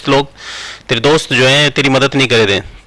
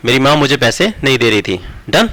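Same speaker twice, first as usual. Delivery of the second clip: ordinary talk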